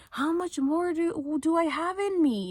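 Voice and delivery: high, drawn-out mock wail; slow, high-pitched retold yell. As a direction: imitate her crying out at the end of her rope. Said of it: "she was, like, yelling".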